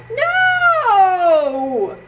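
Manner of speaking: loud high little no; defiant no. Acted. Cornelius refusing to go to school, loud high no. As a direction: shout it like a stubborn child.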